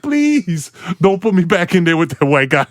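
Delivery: high-pitched, mock-desperate begging; hammed-up plea with a long, high "please"; scared pleading. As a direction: beg comically not to be sent back in. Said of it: "ever scared to be".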